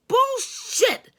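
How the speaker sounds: sudden furious shout; yelled. A narrator's reading. Narrator yells Bullshit at her partner on the phone, furious shout. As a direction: yell it at him before hanging up. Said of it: "I yelled".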